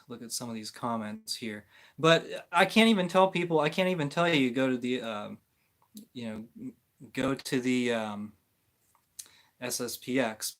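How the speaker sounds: ordinary talk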